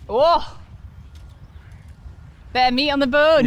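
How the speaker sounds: excited. Real, not acted. Reacts to strong shot.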